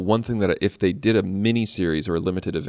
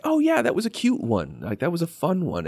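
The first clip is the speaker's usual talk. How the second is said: cheerful recollection; 9 semitones higher than usual. Retold or imitated. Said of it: "And I remember thinking".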